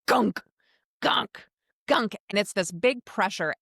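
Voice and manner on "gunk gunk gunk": imitating the gun's gunk sound; biopsy gun noise. Telling the host about the biopsy, mimicking the gun's gunk. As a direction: mimic the machine's heavy thunk. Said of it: "It's like".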